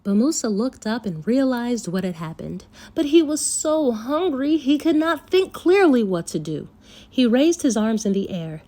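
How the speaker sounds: plain reading voice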